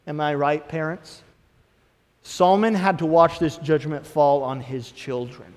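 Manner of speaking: ordinary talk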